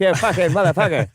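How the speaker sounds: laughing mock Irish accent; bad Irish accent through a laugh. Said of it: "how do you do an Irish accent".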